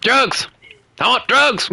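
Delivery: high yelled meme quote; reference quote. Retold or imitated. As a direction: shout it like the famous clip. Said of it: "Please tell me you got that reference".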